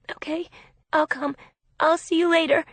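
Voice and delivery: high, hesitant nervous agreement; uneasy, high-pitched goodbye. A narrator's reading. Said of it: "sounding nervous".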